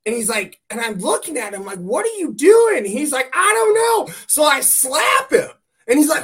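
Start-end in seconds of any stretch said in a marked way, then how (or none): 1.8-2.8 loud, high, drawn-out demand; confronting yell. Retold or imitated. Dog walker confronting a wild driver, loud incredulous yell. 3.3-4.1 loud, high-pitched mock wail; mock crying. Dazed driver answering an angry dog walker, loud mock crying. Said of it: "like, crying".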